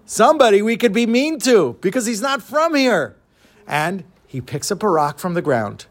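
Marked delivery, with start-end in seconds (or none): 0.1-3.1 put-on higher, mischievous little-boy voice; gleeful little boy. Little boy spots a visitor to be mean to, gleeful. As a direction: relish spotting someone to pick on. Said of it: "this little boy sees him and says".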